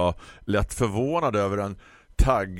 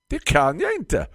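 grumpy florist; 13 semitones higher, 6 dB louder than usual. Acted. Florist lectures the customer about ordering online, high condescending voice.

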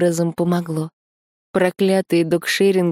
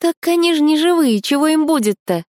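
put-on much higher, chirpy girlfriend voice; bright friend voice; 11 semitones higher, 5 dB louder than usual. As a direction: pop in curious and high. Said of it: "Пожала плечами Таня".

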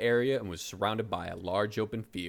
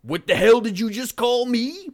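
loud, much higher offended outburst; outraged grunt; 13 semitones higher, 9 dB louder than usual. Acted. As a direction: bellow it as a wounded brute. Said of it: "Braun grunted".

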